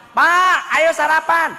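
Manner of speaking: a wife yelling across the house that breakfast is ready; loud wife calling. Acted. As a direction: call him loudly to breakfast, high and insistent. Wife calls husband to breakfast.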